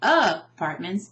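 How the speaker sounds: "apartments" with misplaced stress on opening A; stress on first syllable. Shown as a demonstration. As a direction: punch the A at the start of "apartments". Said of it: "because we don't say".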